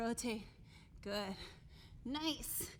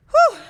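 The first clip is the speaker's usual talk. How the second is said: high, loud relieved exhale; breathy whew; 12 semitones higher, 17 dB louder than usual. Real, not acted. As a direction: blow out after effort. Exhale after hard set.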